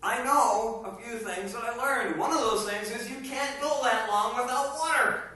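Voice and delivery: ordinary talk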